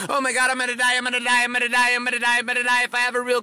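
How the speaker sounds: high monotone chant of panic; mock panicked yelling. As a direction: rattle it off in hammed-up hysteria. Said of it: "stop yelling at yourself and saying".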